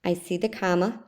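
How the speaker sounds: ordinary delivery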